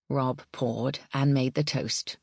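ordinary delivery

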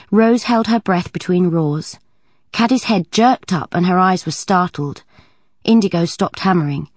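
usual speaking voice

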